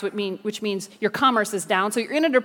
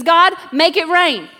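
loud, slow plea with raised pitch; impassioned prayer shout; 4 semitones higher, 6 dB louder, slower than usual. Acted. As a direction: cry out to God, drawing out each word. Acted prayer, calling on God.

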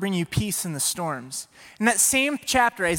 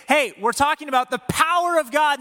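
put-on higher-pitched children's leader pitch; rally a room of kids; hyping up kids; 7 semitones higher, 4 dB louder than usual. Leader telling the kids about God's power, high and excited.

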